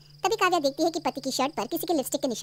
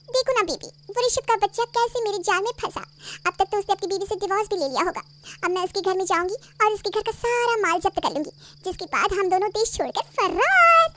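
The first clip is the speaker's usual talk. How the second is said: higher, wheedling lover's voice; coaxing mistress; 6 semitones higher than usual. Acted. Mistress sweet-talking him to marry her. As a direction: coax him into leaving his wife.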